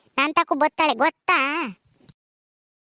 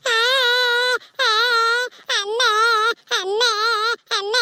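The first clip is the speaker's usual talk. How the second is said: put-on crying baby; 4 semitones higher than usual. Baby wants to be carried by the women, crying. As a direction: wail like a toddler wanting to be picked up. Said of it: "ಅಳ್ಬಾರ್ದಪ್ಪ".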